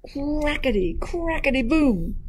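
high, hammed-up cracking words; cracking egg. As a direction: play up the big hatch, high. Largest egg bursts open.

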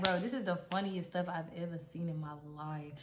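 low, hushed confession; private aside. Retold or imitated. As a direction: drop the voice for a secret thought. Friend's secret amused thought.

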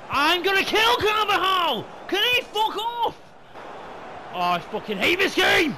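high, full-force furious yelling; furious rage. Real, not acted. Gamer raging at Carvajal, furious shouting. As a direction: explode at the game in real rage.

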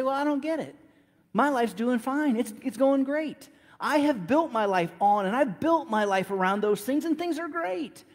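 high, confident voice of a satisfied skeptic, played lightly; smug doubter. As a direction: brush it off like life is fine, pitched well up. Imagined smug listener objecting.

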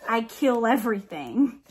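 normal talking voice

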